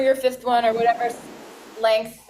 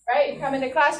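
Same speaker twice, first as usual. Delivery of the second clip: ordinary talk, nothing marked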